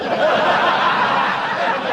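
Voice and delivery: short loud burst of laughter. Real, not acted. Cracking up at the old lady's line, loud laugh burst.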